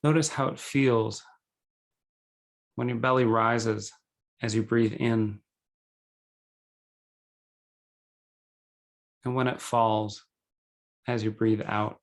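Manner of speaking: calm, measured meditation guide with long pauses; guided meditation. Acted. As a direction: guide them gently into breathing, unhurried.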